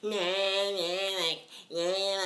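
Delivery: exaggerated American twang noises; mock American accent. Showing how the American accent sounds, mocking American accent. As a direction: mimic an ugly American accent in drawn-out syllables. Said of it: "the American accent is very".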